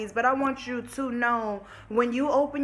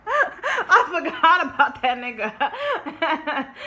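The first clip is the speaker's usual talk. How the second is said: real laugh breaking through the words, louder; 6 semitones higher, 6 dB louder, much slower than usual. Real, not acted. Telling viewers she forgot her ex, laughing out loud. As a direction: crack up over how fast she got over him. Laughing about forgetting him.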